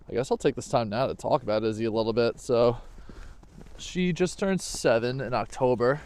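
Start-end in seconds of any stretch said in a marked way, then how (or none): none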